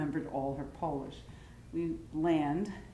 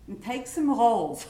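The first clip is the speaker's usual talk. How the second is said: loud, raised, drawn-out mock order; bossy relative; 5 semitones higher, 8 dB louder, slower than usual. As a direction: chime in insistently as the meddling relative. Acted relative joining the argument.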